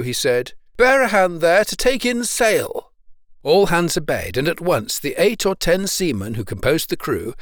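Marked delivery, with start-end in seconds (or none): 0.8-2.9 raised, higher voice of command; calling orders. A narrator's reading. Young sailor turns to the crew, calling out an order. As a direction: call out an order across the deck. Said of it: "turning to the crew".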